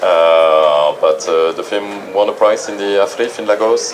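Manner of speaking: usual speaking voice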